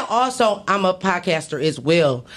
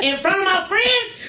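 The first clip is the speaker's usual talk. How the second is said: laughing plea; 9 semitones higher, slower than usual. Real, not acted. Mock plea while laughing.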